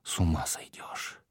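breathy whisper to himself. A narrator's reading. Prince unnerved by his own reflection, whispering. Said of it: "прошептал он".

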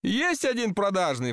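high-pitched, ingratiating offer; lightly acted wheedling; insinuating. A narrator's reading. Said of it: "вкратчиво сказал месье Подлинник".

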